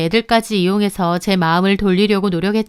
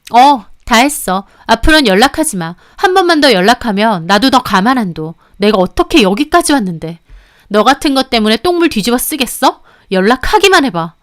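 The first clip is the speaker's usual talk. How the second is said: cold threat; 4 dB louder than usual. Acted. Mistress threatens the husband, cold. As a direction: warn him off for good. Quarrel in recorded phone call.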